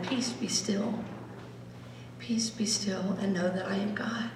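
meditative whisper; whispered meditation. Shown as a demonstration. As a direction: breathe the words.

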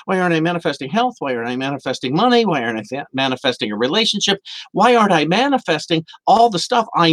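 fast, rattled-off questions; fast frustrated listing; rapid complaints. Retold or imitated. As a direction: rattle off the list quickly. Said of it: "rapid list of complaints".

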